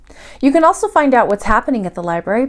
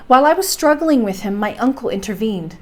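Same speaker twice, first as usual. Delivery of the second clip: ordinary delivery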